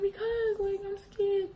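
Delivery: high and soft, frightened; small scared voice. Retold or imitated. Girl answering mom, high, soft and scared. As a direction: say it timidly like a scared kid. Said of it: "I was like".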